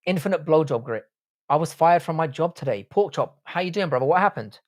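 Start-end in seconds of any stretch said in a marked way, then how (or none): none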